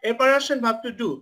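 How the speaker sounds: usual speaking voice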